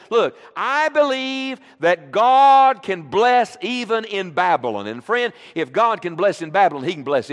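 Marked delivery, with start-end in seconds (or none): 0.1-4.9 louder, higher, slow proclamation; proclaiming. Acted. Preacher proclaiming to the church, louder, slower and higher. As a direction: declare it slowly with force. Climactic declaration.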